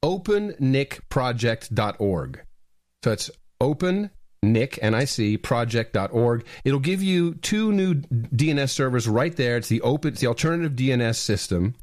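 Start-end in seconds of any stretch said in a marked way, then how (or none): 0.0-6.4 slow and deliberate so listeners can write it down; slow dictation. Shown as a demonstration. Host dictates web address for listeners to memorize, slowly. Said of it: "memorize it".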